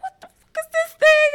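high, fast hammed-up outrage; mock furious son. Son baffled by a roundabout, high mock rage. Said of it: "He was like".

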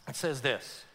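usual speaking voice

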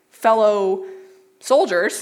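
slow, halting "fellow soldiers" with a pause before each word; groping for a word. Real, not acted. Preacher blanking on a word mid-quote, halting. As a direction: stall while hunting for "soldiers". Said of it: "couldn't think of soldiers for a second".

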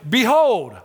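grand archaic Behold. Shown as a demonstration. Preacher mocking old-fashioned phrasing, grand and lofty. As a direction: boom out Behold like old scripture. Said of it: "We don't talk like that anymore".

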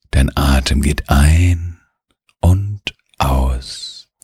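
slow, drawn-out relaxation voice with long pauses; guided meditation. Acted. Narrator settling a child in bed to rest, guided meditation. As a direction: lull the listener, stretching the key words.

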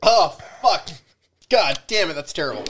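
real high-pitched disgust at the taste; disgusted outburst. Real, not acted. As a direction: recoil from a foul drink. First sip of awful liquor.

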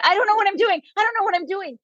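imitating herself freaking out at a stranger's table; panicked. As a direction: blurt it out in a retold panic. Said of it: "And I just freaked out".

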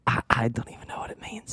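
soft breathy whisper. Real, not acted. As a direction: keep it hushed and secret. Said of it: "Why are we whispering?".

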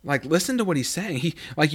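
ordinary talk, nothing marked

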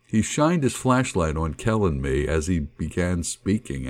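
ordinary delivery, nothing marked

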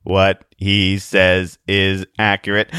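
slow monotone android; word by word, mechanically even pitch; obviously robotic voice. Acted. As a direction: speak like a crude talking machine. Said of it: "said in an obviously robotic voice".

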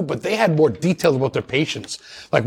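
ordinary talk, nothing marked

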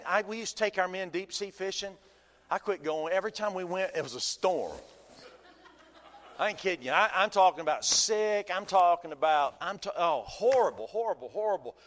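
normal talking voice

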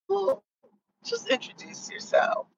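soft whisper so the table can't hear; whisper to son. Real, not acted. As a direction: lean in and whisper the nudge to your son. Mother nudging son at table.

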